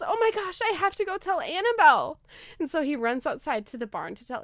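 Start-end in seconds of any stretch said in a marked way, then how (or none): 0.0-2.2 put-on high excited kid voice; rushing with joy; excited boy. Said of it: "And he goes".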